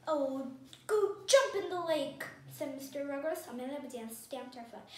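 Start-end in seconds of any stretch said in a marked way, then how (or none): 0.1-2.2 mildly fed-up dismissal, slow and loud; louder, drawn-out grumble of annoyance; exasperated. A narrator's reading. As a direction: send them packing, a touch irritated.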